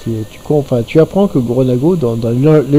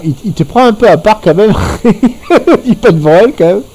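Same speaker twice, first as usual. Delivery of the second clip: laughing; 7 semitones higher, 3 dB louder than usual. Real, not acted. Laughing at the game master's line.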